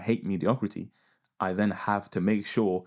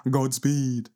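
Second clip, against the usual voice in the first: higher and louder, grand farewell; dramatic sign-off; 6 semitones higher, 5 dB louder than usual. Acted. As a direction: boom it out like a heroic blessing. Sign-off said twice.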